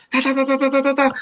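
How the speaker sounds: high rapid "da da da" babble; mock fast-talking instructor; imitating fast Portuguese. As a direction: mimic a rapid-fire scolding in nonsense syllables. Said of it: "you was talking very fast".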